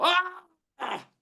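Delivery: high startled yelps; mock yelps. Triggered person yelping at the sound. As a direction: squeal at the trigger. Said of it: "they go".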